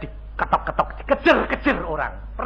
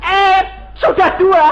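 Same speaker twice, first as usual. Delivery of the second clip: shout; 13 semitones higher, 9 dB louder than usual. Real, not acted. Preacher exclaiming to congregation.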